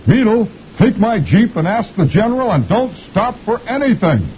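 high cartoon voice barking orders; squeaky officer sending Beetle off; captain giving orders. Captain orders Beetle to the general, high squeaky command. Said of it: "the captain says".

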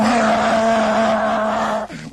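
long, loud imitated roar; drunk driver's roar. Storyteller imitating the drunk driver barreling along, long loud roar. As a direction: roar it out and hold it. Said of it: "and he's going".

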